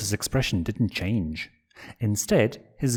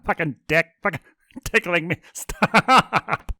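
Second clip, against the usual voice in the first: much higher, louder laughing protest; laughing plea; 8 semitones higher, 4 dB louder than usual. Acted. Blitz begging Stolas to stop, cackling. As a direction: yell stop while cackling. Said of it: "cackling like a madman".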